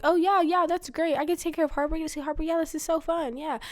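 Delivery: high-pitched fake enthusiasm; put-on chirpy delight; pretend happy. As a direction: gush to cover the upset. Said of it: "pretending I was, like, happy".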